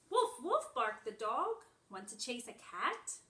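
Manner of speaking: animated picture-book read-aloud; reading to children. Acted. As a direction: read it brightly to little ones. Reads picture book to class.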